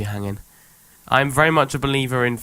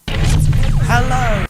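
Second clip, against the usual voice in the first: loud greeting; 13 semitones higher, 8 dB louder than usual. Acted. Intro music starts, shouted greeting.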